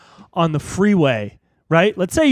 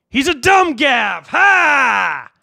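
high, loud, drawn-out jeer; hammed-up taunting yell; mock taunt; 9 semitones higher, 6 dB louder, much slower than usual. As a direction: shout the insult, then bark a big ha.

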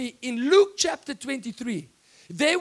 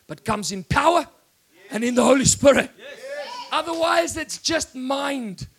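louder, driving sermon cadence; full-force preaching; 3 semitones higher, 6 dB louder than usual. Real, not acted. Preacher praising Jesus before the congregation, loud and rising. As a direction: preach it home, building to praise.

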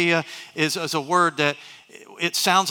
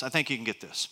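repeated much softer, lower; quiet; 5 semitones lower, 10 dB quieter than usual. Shown as a demonstration. Pastor reassuring the congregation, soft and low. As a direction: drop it to a soft, lower aside. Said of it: "watch this".

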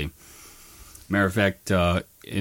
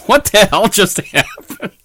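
high, loud, laughing disbelief; baffled and laughing at once; stunned onlooker laughing in disbelief; bewildered; 12 semitones higher, 7 dB louder than usual. Retold or imitated. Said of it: "standing there like".

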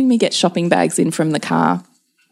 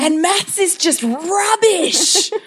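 high, slow hammed-up anger; mock tantrum; 12 semitones higher, much slower than usual. Mother grabbing her son's homework book, mock tantrum. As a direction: stretch the hatred out theatrically. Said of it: "slammed a book and gone".

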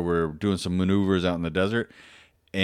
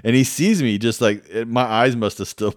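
usual speaking voice

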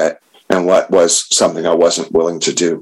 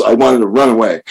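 ordinary talk, nothing marked

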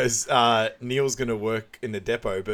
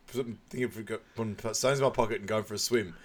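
ordinary talk

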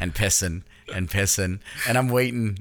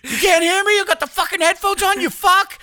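very high, loud imitated outburst; mock furious shout; 6 dB louder than usual. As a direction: shriek it as a hammed-up rage. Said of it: "when she gets mad, she's like".

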